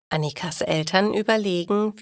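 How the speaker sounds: plain reading voice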